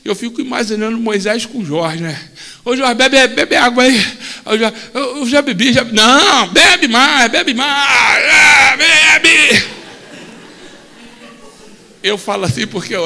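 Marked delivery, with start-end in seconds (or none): 2.6-9.8 loud, pushy urging to drink; loud playful insisting. Acted. Moses urging Jorge to drink.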